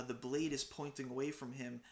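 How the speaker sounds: normal talking voice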